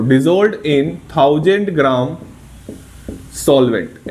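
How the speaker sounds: "dissolved in thousand gram solvent" drawn out very slowly; teacher reading the definition aloud, very slow; slow demo reading. Shown as a demonstration. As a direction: spell it out slowly for the students. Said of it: "ऐसे पढ़ा जाता है इसको".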